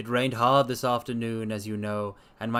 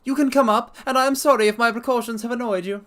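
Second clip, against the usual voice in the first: very high, loud threatening squeak; frightened fat patient warning intruders, very high; reedy, quivering voice; 12 semitones higher, 6 dB louder, faster than usual. Acted. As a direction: threaten from the dark in a high panicked voice. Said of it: "said the voice at last".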